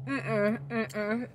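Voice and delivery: chewing while talking; muffled through sticky candy; mouth full of candy. Real, not acted. Seen 2: Left speaker's lips pursed, working candy, hand at mouth; removed by 5.2.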